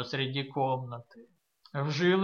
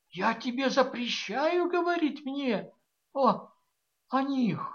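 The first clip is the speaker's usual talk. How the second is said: trembling voice; 6 semitones higher than usual. A narrator's reading. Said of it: "произнесла дрожащим голосом".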